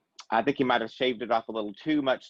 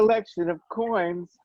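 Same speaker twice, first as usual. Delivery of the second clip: higher put-on collector voice; mock-snooty echo; 6 semitones higher, 4 dB louder than usual. Host teases the coin collector, higher mock-snooty voice. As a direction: echo it in a silly expert voice.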